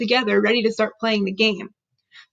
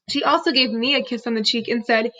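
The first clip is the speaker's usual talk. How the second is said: ordinary delivery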